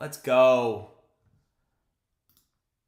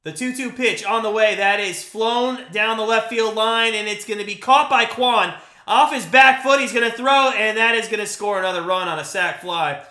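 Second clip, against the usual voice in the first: rising, louder excited call of the fly ball; live play-by-play; 3 semitones higher, 7 dB louder than usual. Acted. Fan streamer calls the sac fly for his chat, excited play-by-play. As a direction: call the catch and throw like a radio announcer.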